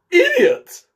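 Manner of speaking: loud, high scornful insult; impatient. Acted. The Queen insulting the bowing Knave, loud and impatient. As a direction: spit it out in royal contempt. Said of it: "tossing her head impatiently".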